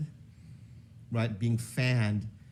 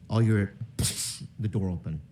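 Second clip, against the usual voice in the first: usual speaking voice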